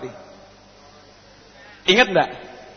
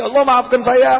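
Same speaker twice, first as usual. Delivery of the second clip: higher, insistent penitent voice; pleading confession; 7 semitones higher than usual. Acted. Preacher acting a penitent confessing forgetful prayer to God, pleading. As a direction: confess it urgently, climbing in pitch.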